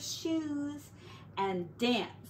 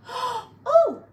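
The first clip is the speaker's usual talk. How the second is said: high, loud startled "Oh!"; sudden high cry of surprise; surprised; 13 semitones higher, 11 dB louder than usual. A narrator's reading. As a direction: gasp it out in surprise. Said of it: "said Missus Potter in surprise".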